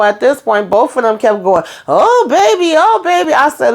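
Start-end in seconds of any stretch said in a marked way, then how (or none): 1.9-3.3 mock lovers' moan, pitched way up. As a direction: parody the couple moaning in a high falsetto. Imitating couple moaning on show.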